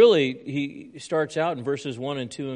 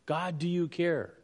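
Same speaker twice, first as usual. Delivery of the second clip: desperate plea; 5 semitones higher than usual. Retold or imitated. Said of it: "cry out to God".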